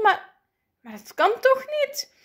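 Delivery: very high, loud disbelief; incredulous. A narrator's reading. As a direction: squeak it out in amazement. Cannot believe he has won.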